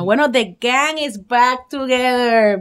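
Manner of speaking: announcer voice. Acted. Announcing return.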